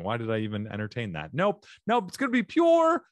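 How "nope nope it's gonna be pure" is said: squeaky voice. Real, not acted. Comedian plugging his show, voice squeaking high. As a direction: voice jumps way up into a squeak. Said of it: "I squeaked on that".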